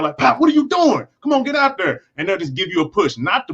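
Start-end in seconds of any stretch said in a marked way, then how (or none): 0.2-2.0 coach yelling. Retold or imitated. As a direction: yell it like a coach firing up his player. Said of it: "they might slap you on your shoulder pad".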